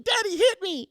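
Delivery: put-on high-pitched little girl accusing her dad; wailing child. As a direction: shriek it like a tattling four-year-old, mock outrage. Four-year-old cries out in concert.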